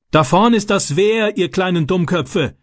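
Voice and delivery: high-pitched narrated yell, no real volume; angry bellow. A narrator's reading. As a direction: yell a warning from the riverbank, held back in volume. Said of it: "brüllte der Mann". (zorniges Brüllen, hoch, mit gebremster Lautstärke)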